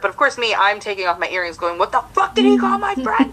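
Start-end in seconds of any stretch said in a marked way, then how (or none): 1.8-3.3 raised-pitch indignant demand; indignant retold outburst; mock outrage. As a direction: act out charging into a fight. Retold outrage, ready to fight.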